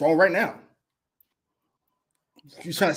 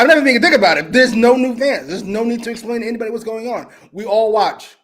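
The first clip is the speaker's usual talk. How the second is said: loud, raised-pitch insistence; heated argument; 6 semitones higher, 8 dB louder than usual. Real, not acted. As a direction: cut in loudly and hammer the point. Arguing over a co-host.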